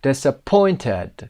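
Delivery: DISAPPOINTED with the third syllable, POINT, lifted and stretched; stress on POINT. Shown as a demonstration. Teacher gives number two, stress on POINT in DISAPPOINTED. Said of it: "قسمت پوینت".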